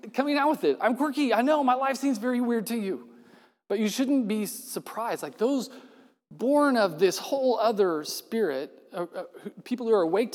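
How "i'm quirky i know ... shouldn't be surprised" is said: high, put-on Jesus, a touch louder; Jesus cheerfully owning his oddness; lightly hammed-up Jesus; playful Jesus voice.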